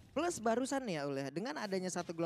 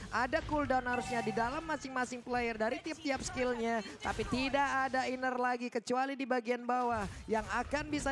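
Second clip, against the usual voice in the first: play-by-play commentary =29; 3 semitones higher, 3 dB louder than usual. Acted. Live esports play-by-play.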